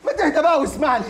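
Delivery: loud pleading. Acted. Shafei begging an enraged Mahmoud to calm down, loud pleading.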